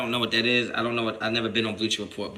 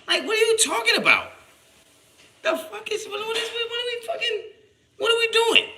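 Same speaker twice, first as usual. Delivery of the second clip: way higher, half laughing in disbelief; incredulous squeal. Real, not acted. Streamer mocking chat accusing him of ranting, squealing. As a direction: squeak out the outrage. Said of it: "I'm ranting".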